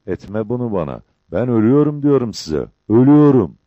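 complaint rising to a loud cry; grumbling protest. A narrator's reading. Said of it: "Çavuş mızırdanıyordu".

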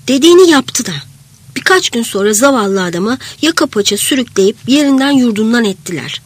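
usual speaking voice

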